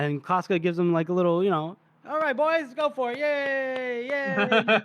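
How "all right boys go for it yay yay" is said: high-pitched, half-hearted hurrah; rally the boys in a high mock cheer. Acting out captain cheering men on.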